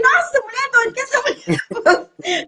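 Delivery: very high, half-crying protest; mock-horrified wail. Girl reacting to the crazy woman, wailing in mock horror. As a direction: squeal it in comic dismay. Retells horrified refusal of the mother.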